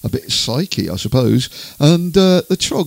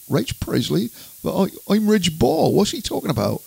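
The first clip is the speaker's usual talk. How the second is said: lightly acted bewilderment, softer; puzzled singer; 7 semitones higher, 3 dB quieter than usual. Singer reading his new name in the paper, mock puzzlement. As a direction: imitate his baffled reaction to the name. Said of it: "And he thought".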